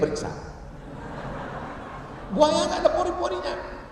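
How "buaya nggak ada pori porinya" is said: high, softer comic voice; mock discovery. Zoo visitor checks the crocodile, high comic voice. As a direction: squeak it lightly as if just finding out.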